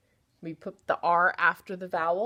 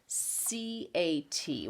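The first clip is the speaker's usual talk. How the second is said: C A T spelled slowly, letter by letter; 5 dB quieter, much slower than usual. Shown as a demonstration. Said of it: "what is that word?".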